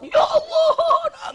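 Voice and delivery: very high, drawn-out wail; mock sobbing cry to God; wailing repentance. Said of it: "menangis dalam tobat".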